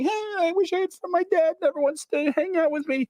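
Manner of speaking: put-on very high complaining child voice; sad little boy. Boy telling the servant his dad ignores him, very high voice. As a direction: whimper it like a lonely kid. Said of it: "He's like".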